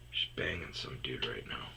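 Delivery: low, very soft mutter; thinking aloud to yourself, low and soft; muttered aside. Real, not acted. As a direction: say it under your breath.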